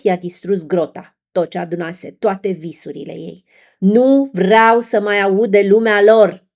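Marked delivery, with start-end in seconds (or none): 3.8-6.5 loud, slow, furious roar; furious shout. A narrator's reading. King Triton shouts at his daughter, furious. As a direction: bellow it like an enraged sea king. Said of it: "a strigat el".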